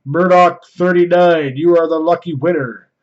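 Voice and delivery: ordinary talk, nothing marked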